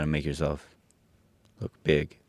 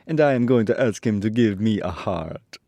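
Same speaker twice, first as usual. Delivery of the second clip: higher, louder tin man voice; Tin Woodman; 5 semitones higher, 6 dB louder than usual. A narrator's reading. Tin Woodman planning to ask Oz, higher character voice. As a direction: push the pitch up for the tin man. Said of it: "said the Woodman".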